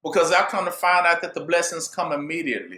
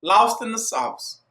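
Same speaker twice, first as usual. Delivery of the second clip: ordinary delivery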